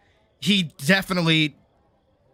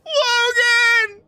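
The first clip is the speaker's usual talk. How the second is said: very high, louder calls for a coworker; calling a coworker over a haywire machine, mock panic; mock panicked calling; 3 dB louder, much slower than usual. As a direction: yell his name in mock panic. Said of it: "a machine would be going haywire and be like".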